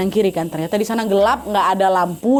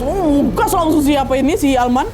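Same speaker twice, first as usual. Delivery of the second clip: ordinary delivery, nothing marked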